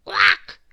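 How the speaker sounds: loud, high quack; duck quack. Acted. Ducks in the farmyard tangle, quacking. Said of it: "quacked the ducks".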